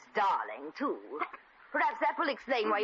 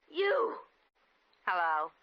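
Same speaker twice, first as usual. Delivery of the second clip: ordinary delivery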